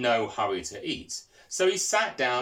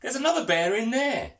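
panting; 10 semitones higher, 3 dB louder than usual. Acted. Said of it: "panted Smithy".

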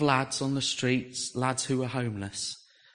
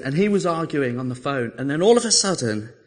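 usual speaking voice

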